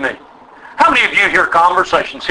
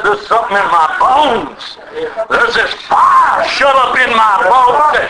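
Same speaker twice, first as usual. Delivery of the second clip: prophet's cry; 5 semitones higher than usual. Retold or imitated. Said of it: "all of a sudden he said".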